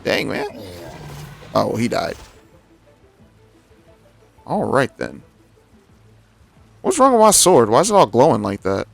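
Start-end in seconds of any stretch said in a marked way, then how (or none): none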